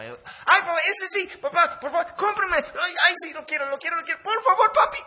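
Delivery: put-on high, loud, laughing little-girl begging; squealing child pleading for a toy; whiny little girl. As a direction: beg like a greedy child in the store.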